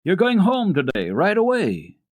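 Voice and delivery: put-on hearty master of the house delighting the child; jovial gentleman. A narrator's reading. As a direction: break the good news with a beaming voice. Sesemann announces happy news.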